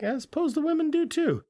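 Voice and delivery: high, goofy put-on voice; mock dim voice. Agreeing about the women, in a high goofy voice. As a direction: say it like a clueless goof. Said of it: "He's like".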